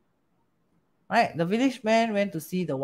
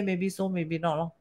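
calm wise man; 8 dB quieter than usual. Retold or imitated. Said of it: "Wise man say".

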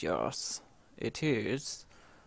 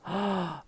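quick soft gasp; 5 semitones higher, 6 dB quieter than usual. Real, not acted. Startled before chicken attack.

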